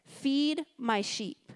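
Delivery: drawn out, each word set down; slow, weighty command. Acted. Jesus to Peter on the shore, slow and weighty. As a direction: let the words land heavily.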